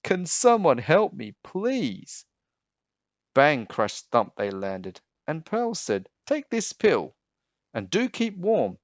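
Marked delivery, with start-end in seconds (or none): 0.0-2.3 high, begging sick lion; pleading lion. Acted. Sick lion pleads with the flying doctors. 6.3-8.8 put-on high, brisk young doctor; Princess Pearl. Pearl tells the sick lion to keep warm.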